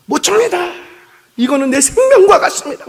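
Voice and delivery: high, loud desperate plea; pleading refusal. Retold or imitated. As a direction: beg as if your life depends on it. Recruit refusing to hand over Bible.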